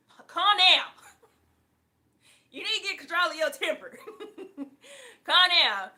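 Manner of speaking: loud, high, laughing calm down. Real, not acted. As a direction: tease the hothead through laughter. Telling caller to calm down.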